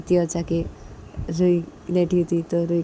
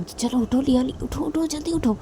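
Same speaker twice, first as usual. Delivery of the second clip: higher, coaxing wake-up call; waking the child up; 8 semitones higher than usual. Acted. Father nudging sleepy daughter awake, coaxing. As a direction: rouse the little girl briskly.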